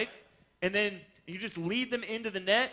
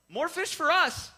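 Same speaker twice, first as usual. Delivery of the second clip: cheerful shrug; 9 semitones higher, 6 dB louder than usual. Retold or imitated. Shrugging off Jordan's refusal.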